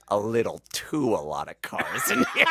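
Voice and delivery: cracking up through a deadpan line; laughing hard mid-quote; laughing alien line. Retold or imitated. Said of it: "And the alien goes".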